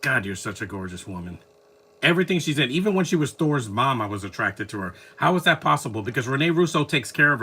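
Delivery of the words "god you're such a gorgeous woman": swooning. Real, not acted. Swooning over actress on screen.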